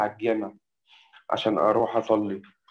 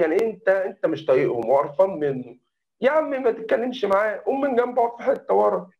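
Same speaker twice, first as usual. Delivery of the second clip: higher-pitched imitation of mom telling him off; mother scolding; 8 semitones higher than usual. Mother scolds her son after the lesson trouble, higher mock voice. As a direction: play your mom's rebuke, pitched up.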